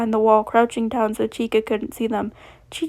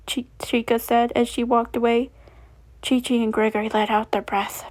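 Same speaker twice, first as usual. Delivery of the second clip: plain reading voice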